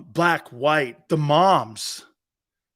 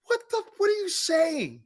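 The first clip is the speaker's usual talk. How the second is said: baffled; 11 semitones higher than usual. Retold or imitated. Retold confused reaction.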